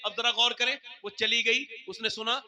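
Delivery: ordinary delivery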